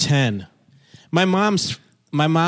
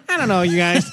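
imitating younger self, much higher pitched; high weaselly voice; 4 semitones higher than usual. Guest mocking his own sophomore self, high and weaselly. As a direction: squeak it like a small, weaselly teen. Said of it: "I had this high weaselly voice".

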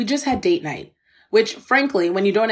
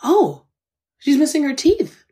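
surprised remark; 7 semitones higher, slower than usual. Retold or imitated. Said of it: "And I say to the woman".

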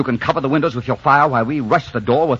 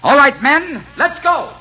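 high, loud call to charge; rally the men forward; 6 dB louder, slower than usual. Acted. Philip ordering the men to attack the cabin, high and loud.

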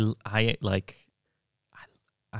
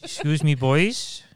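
imitating a Swansea man's Welsh lilt; Swansea Welsh accent; 2 semitones higher than usual. Shown as a demonstration. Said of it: "one of the best Welsh accents".

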